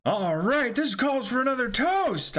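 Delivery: rally the crew to drink; captain calling a toast. Acted. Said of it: "would be Luffy saying that".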